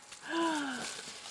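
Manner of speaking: high, soft gasp of amazement; overwhelmed gasp. Real, not acted. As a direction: draw breath in, overwhelmed. Moved by a friend's gift.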